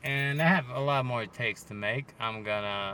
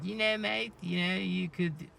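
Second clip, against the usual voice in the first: higher-pitched mock driver voice; Max; 5 semitones higher than usual. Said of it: "Max being Max, like".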